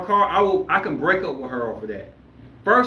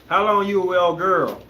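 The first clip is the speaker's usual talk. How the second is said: ordinary delivery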